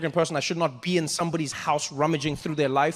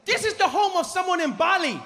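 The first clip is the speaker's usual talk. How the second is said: very high, excited tour guide; overjoyed guide showing off the sights; Deng Basan; 12 semitones higher than usual. Acted. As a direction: announce it proudly in a high pitch. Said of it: "and he's like".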